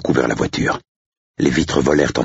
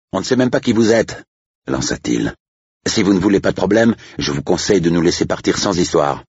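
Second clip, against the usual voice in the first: higher-pitched, irritated warning; indignant estate agent; 6 semitones higher than usual. Acted. Estate agent warns the strangers to let them leave, indignant. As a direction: push back in a raised pitch.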